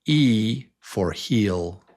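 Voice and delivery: slow, drawn-out long E in "heel"; long vowel e. Shown as a demonstration. Teacher modelling the long E for the class. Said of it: "on the right is the long vowel sound".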